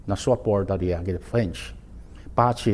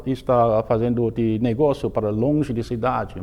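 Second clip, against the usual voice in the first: normal talking voice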